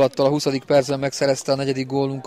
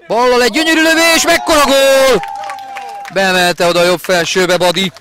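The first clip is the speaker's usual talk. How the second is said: much higher, louder goal cry; excited hungarian sports commentary; 8 semitones higher, 7 dB louder than usual. Real, not acted. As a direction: explode on the strike. Live goal call.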